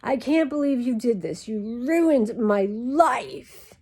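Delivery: high mock-teen tantrum, last words dragged out; hammed-up teen outrage, stretched out slow at the end; typical teenager. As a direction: imitate a melodramatic teenage daughter. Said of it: "A typical teenager".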